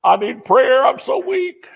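high-pitched mock plea; put-on helpless whine; mock self-pity. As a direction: imitate someone pleading helplessly. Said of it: "Instead of".

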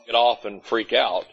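ordinary delivery

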